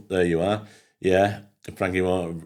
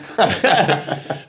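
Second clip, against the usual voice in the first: big laugh; 10 semitones higher than usual. Real, not acted. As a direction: let the laugh take over, rising high. Still laughing at the story.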